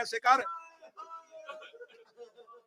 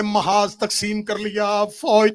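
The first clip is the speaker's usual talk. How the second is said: slow, rising to loud wailing; preaching at full force; 2 semitones higher, 12 dB louder than usual. Acted. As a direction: drag each phrase, then cry out. Slow build to shouted lament. (آہستہ شروع، پھر بلند بین)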